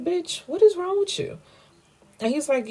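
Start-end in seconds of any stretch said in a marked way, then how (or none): 0.0-1.4 high, incredulous squeal of disgust; outraged disbelief. Real, not acted. Vlogger reacting to the wife's fake pregnancy, outraged disbelief. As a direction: shriek it like you can't believe her nerve.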